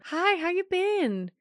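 high, drawn-out cutesy small talk; high-pitched, falling sing-song questions; playful mock greeting. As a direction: greet them in a put-on high voice.

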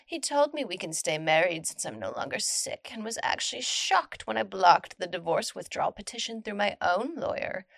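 ordinary delivery

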